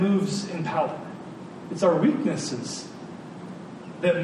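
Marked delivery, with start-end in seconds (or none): none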